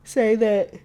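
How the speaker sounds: loud, high ghostly plea; a hint of a begging ghost. Ghost voice retold.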